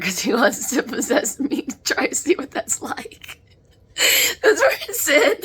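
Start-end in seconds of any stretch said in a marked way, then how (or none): 3.9-5.3 high, loud laughter through tears; laughing. Real, not acted. Medium laughing at the spirits' antics. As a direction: crack up mid-sentence.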